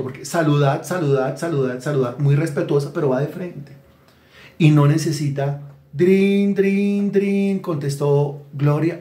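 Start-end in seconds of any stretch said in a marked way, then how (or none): 5.9-7.6 higher, sing-song "drin, drin, drin" like a phone bell; phone ring. Pretend. Preacher acting out a phone call, playful ringing sound. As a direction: ring it like a telephone.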